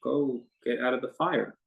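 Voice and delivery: ordinary talk, nothing marked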